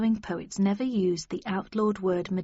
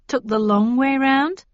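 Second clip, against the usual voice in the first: sarcastic; 5 semitones higher, 6 dB louder than usual. A narrator's reading. Sarcastic at cabbie.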